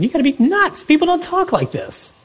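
very high-pitched mock disbelief; incredulous reaction. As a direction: react as if the claim is plainly crazy. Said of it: "See, here's the right reaction".

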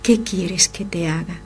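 soft whispered gentle question; with sweetness. Acted. Jesus asking the blind man gently, soft whisper. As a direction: ask it tenderly, barely above a whisper. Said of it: "con la dulzura que le caracterizaba".

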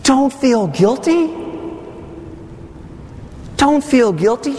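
high, put-on soothing voice; mock-coax the listener out of guilt; coaxing culture-of-death voice. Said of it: "culture of death today would tell you".

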